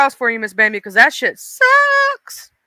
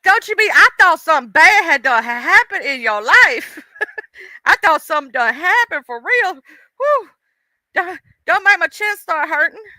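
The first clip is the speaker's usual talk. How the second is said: shaken relief; 6 semitones higher, 4 dB louder than usual. Real, not acted.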